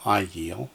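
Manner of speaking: "ayil" as A-yil, first syllable stressed; teacher models "ayil" A-yil. Shown as a demonstration. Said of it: "That accents on the first syllable".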